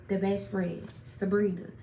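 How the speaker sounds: ordinary talk, nothing marked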